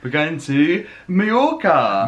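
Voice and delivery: high, loud, drawn-out reveal; excited announcement. Real, not acted. Revealing the holiday destination to viewers, excited announcement. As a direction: sing out the big holiday news.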